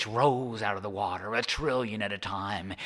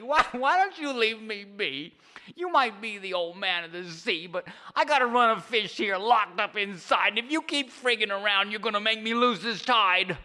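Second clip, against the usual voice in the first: high cranky fisherman scoffing; sarcastic Charlie; 12 semitones higher than usual. Acted. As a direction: sneer back mockingly. Charlie argues with old man.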